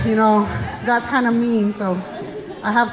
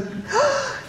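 delighted gasp; 13 semitones higher, 6 dB quieter than usual. Retold or imitated. Grandma sees the baby.

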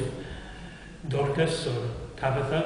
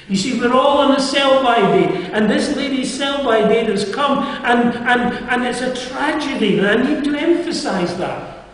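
forceful preaching; 8 semitones higher, 6 dB louder than usual. Acted.

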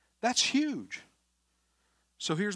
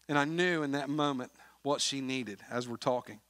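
ordinary talk, nothing marked

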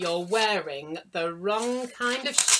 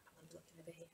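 whispering; 3 semitones lower, 26 dB quieter, much faster than usual. Real, not acted. Aside while setting up.

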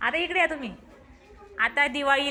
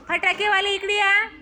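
raised, louder call across the yard; 6 semitones higher, 6 dB louder than usual. Real, not acted. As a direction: call them over, pitch up and louder. Teacher calling kids louder.

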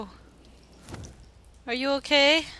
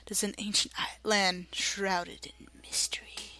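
mysterious whisper; 5 dB quieter than usual. Acted. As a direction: breathe it like a secret legend. Mock mysterious narration.

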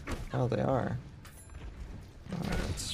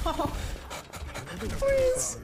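raging gamer; 9 semitones higher, slower than usual. Real, not acted. Losing, begging the game to end.

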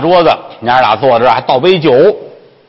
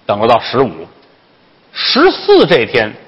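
ordinary delivery, nothing marked

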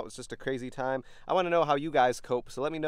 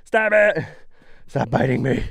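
yelling at bugs; 3 semitones higher, 9 dB louder than usual. Real, not acted. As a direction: shout stop it. Bugs biting him.

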